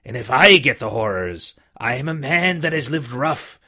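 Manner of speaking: put-on a weak old seaman coaxing the boy; dying buccaneer begging the boy for rum; old sick sea captain.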